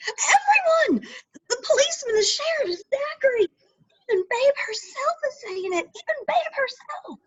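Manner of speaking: high, tearful outpouring; distraught. Acted. Lenny telling Meg that Babe shot Zachary, high and teary. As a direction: spill it out near tears.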